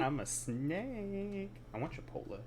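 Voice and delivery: high, very slow snake drawl. Pretend. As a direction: stretch the words like a lazy snake. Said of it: "the snake voice".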